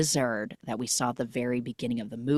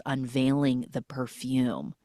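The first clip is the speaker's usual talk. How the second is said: usual speaking voice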